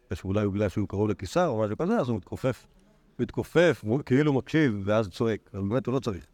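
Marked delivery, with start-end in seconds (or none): none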